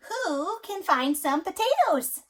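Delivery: high, loud, slow hen asking; little red hen. A narrator's reading. Parent reading to child, hen asks for potatoes. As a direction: draw it out like the eager hen. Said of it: "the little red hen said".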